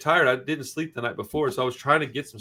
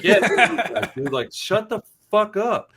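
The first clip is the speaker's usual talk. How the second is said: raised, louder pitch, retelling a put-down; exasperated retold comeback; fed-up telling-off; 5 semitones higher, 4 dB louder than usual. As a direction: tell them off, slowing down for the final order. Retold telling-off of noisy passengers.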